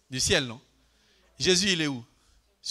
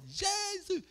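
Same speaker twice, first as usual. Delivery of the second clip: mock called-out Jesus; 14 semitones higher, 6 dB quieter, slower than usual. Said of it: "Dites-le trois fois".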